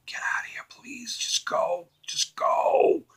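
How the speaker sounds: hushed whisper, pleading him to leave; urgent whisper. Retold or imitated. Yoda silently willing Luke to leave, whispered. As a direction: whisper it like a secret wish. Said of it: "Inside, he's thinking to himself".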